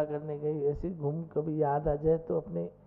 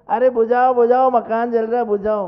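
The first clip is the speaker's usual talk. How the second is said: calling out; 6 semitones higher, 12 dB louder than usual. Retold or imitated. Father calling to villagers.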